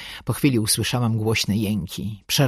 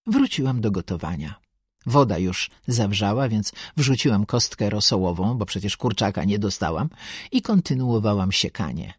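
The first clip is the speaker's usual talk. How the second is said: plain reading voice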